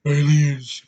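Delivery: hammed-up wide-eyed alarm; Joel Kinnaman. Reviewer mocking an actor's bug-eyed performance, hammed-up impression. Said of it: "his freaking eyes going like this".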